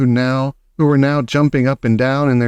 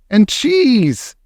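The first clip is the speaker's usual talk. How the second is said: mild triumphant witch shout; lightly triumphant witch; 9 semitones higher than usual. Acted. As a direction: gloat over the plan. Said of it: "shouted the Grand High Witch".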